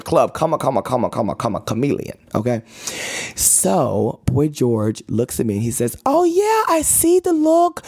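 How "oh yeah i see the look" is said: very high, slow mock English accent; badly faked English voice, drawn out. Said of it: "I can't do no English accent".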